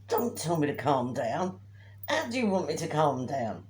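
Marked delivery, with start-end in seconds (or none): none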